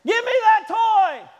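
put-on high, loud demanding kid; screaming little kid; bratty child shout. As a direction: yell it like a toddler grabbing a toy. Child grabbing a toy.

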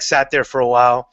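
ordinary talk, nothing marked

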